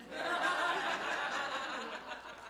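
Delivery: a high, soft burst of laughter; big laugh. Real, not acted. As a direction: laugh at your own joke. Laughs at own joke.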